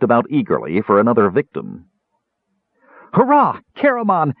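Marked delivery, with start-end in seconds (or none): 3.1-4.3 high, excited kender whoop; wild cheer. A narrator's reading. Tass cheers Caramon on in the fight with a wild, excited cheer. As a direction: cheer him on like a thrilled kid. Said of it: "Tass cheered wildly".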